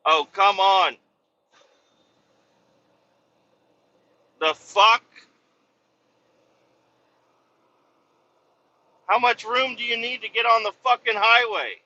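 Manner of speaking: loud, high exasperated outburst; real road rage. Real, not acted. Driver cursing traffic at an on-ramp.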